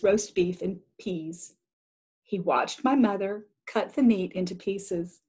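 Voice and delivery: usual speaking voice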